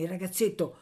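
ordinary delivery

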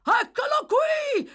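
very high, loud, drawn-out shout; excited bellow. A narrator's reading. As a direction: bawl it excitedly up the stairs. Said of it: "sbraitò a gran voce, eccitatissimo".

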